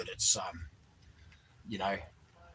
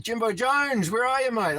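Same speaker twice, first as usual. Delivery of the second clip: calling out; 12 semitones higher, 7 dB louder than usual. Real, not acted. Calling out to a chat viewer.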